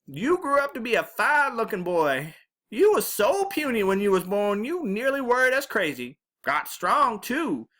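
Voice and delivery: put-on high-pitched, lively old granny voice; fussing Southern grandma. A narrator's reading. Grandma fusses over grown grandson.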